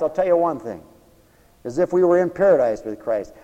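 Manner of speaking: ordinary delivery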